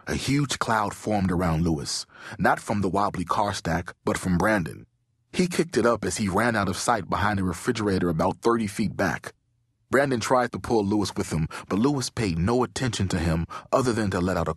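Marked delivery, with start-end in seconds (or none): none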